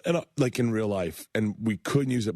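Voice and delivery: ordinary talk, nothing marked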